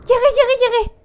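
high, loud yelp of triumph; excited cheer. Real, not acted. Gamer cheering after reaching a ledge, high excited yells. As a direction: shout it like you finally made the jump.

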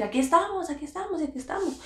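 high, repeated call for help; mock yell without full volume; retold calling out. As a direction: imitate calling to be found, pitched well up. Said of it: "él empezó a gritar así".

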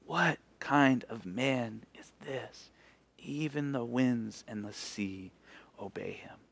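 soft, slow amazed murmur; awed disciples, hushed; hushed awe. Acted. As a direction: wonder aloud very quietly.

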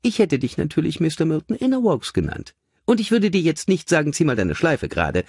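high, chirpy lady's voice; brisk cheerful lady. A narrator's reading. Mother showing her son the figures, brightly high. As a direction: hand it over brightly, lilting high.

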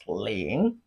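"ling" dipping low, then rising; falling-rising tone. Shown as a demonstration. Teacher models Chinese zero for learners, falling-rising "ling". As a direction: draw out "ling" in a dip and rise. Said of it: "Falling and rising tone".

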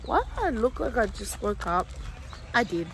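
usual speaking voice

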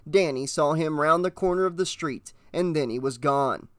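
ordinary delivery, nothing marked

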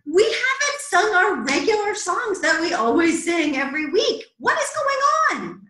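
high, playful exasperation; mock outrage. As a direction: act scandalised for the kids, pitch climbing.

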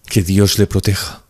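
hesitant, trembling-lipped woman; faltering voice. A narrator's reading. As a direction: say it haltingly, close to tears. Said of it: "murmuró por fin con voz vacilante".

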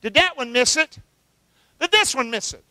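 ordinary delivery

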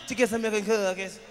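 a hint of a timid child's voice; lightly voiced little boy answering; mimicking the kid. Kid on the stairs explaining himself to the comic, mildly childlike. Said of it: "That's how he said it".

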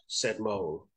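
usual speaking voice

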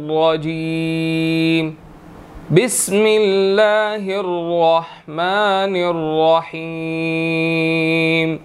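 slow, drawn-out melodic tilawat; Quran recitation. Acted. Teacher begins the verse, slow melodic recitation. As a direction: chant each word long and flowing.

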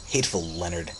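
ordinary delivery

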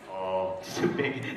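low, put-on manly puppet voice; a big man's voice for the puppet; a deep puppet voice as a joke. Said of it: "that's a manly voice".

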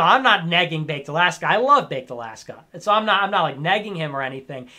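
ordinary talk, nothing marked

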